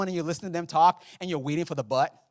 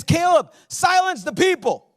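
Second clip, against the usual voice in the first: bold call; 13 semitones higher, 9 dB louder than usual. Retold or imitated. Bold spy speaks up.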